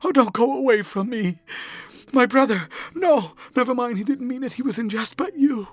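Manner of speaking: high, desperate begging near sobs; passionate pleading. A narrator's reading. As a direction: plead with the lover not to leave, voice pitched high. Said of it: "A fit of passionate sobbing choked her".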